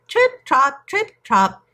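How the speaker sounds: high, punched hoof sounds; chanted hoofbeats. A narrator's reading. Middle goat trots over the wooden bridge, high hoofbeat chant. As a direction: trot the words out high, like light hooves.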